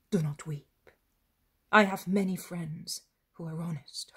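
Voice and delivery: usual speaking voice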